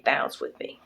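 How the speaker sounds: the hook repeated in a whisper; whispered sing-along echo. Retold or imitated. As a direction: echo it back under your breath. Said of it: "this song comes in".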